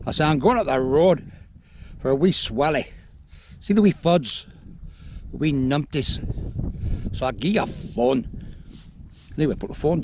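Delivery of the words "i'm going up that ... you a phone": put-on Scottish accent. Prank on a mate over the phone in put-on Scottish accent. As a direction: lay on a homemade Scottish accent for a laugh. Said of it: "just trying out my Scottish accent".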